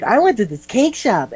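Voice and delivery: high-pitched caricature of a woman; put-on girly voice. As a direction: chirp it high like a pretend girl. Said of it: "his concept of woman is".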